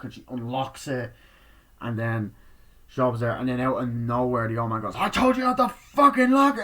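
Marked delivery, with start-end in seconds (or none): 5.0-6.6 high, loud, raging yell; furious yell. Retold or imitated. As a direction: scream at someone who disobeyed you. Old man furious at her.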